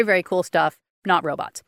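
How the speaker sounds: ordinary talk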